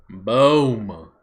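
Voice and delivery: normal talking voice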